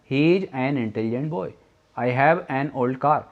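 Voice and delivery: very slow, word by word example sentences; slow dictation. Shown as a demonstration. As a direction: read each example slowly for students. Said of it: "intelligent के according हमें एन लगाना है।". (बहुत धीमे, शब्द-दर-शब्द उदाहरण पढ़ना)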